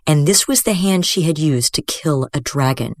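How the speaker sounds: usual speaking voice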